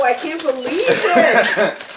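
laughing. Real, not acted. Overjoyed at her gifts.

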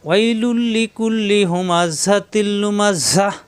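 slow chanted tilawat with long drawn vowels; Quran recitation. Shown as a demonstration. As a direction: recite slowly, stretching each vowel. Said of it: "ওয়াকফ করলে".

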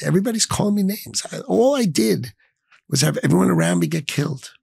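high-pitched, wounded protest; upset. Real, not acted. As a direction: plead it, pitch climbing high with real upset. Recounting attacks after mass loss, upset.

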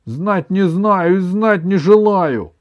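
snapped, loud and slow; grandma refusing outright; curt retort. A narrator's reading. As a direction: cut him off flatly. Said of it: "отрезала бабушка".

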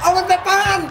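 mock-angry boss shout; shouted order. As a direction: bark the order at him. Boss orders someone away.

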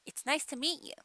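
"meet you" as mee-you with a momentary catch; stop for T. Shown as a demonstration. Teacher repeating the example, T in "meet" stopped. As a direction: replace the T in "meet" with a tiny stop. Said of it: "ストップします".

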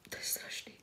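breathless horrified whisper. Acted. Whispering mock horror to the chat viewers.

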